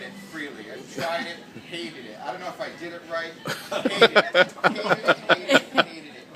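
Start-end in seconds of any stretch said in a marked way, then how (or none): 3.7-6.3 louder, laughing, mock-disgusted; repeated loud complaint. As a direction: hammer the complaint comically. Comic build on hating it.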